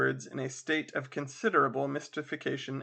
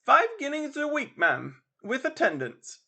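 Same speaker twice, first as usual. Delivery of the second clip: landlady; 11 semitones higher, 4 dB louder than usual. Acted. Landlady quotes price.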